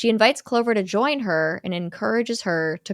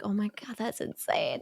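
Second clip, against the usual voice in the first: amazed whisper; 10 dB quieter than usual. Retold or imitated. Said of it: "I was like".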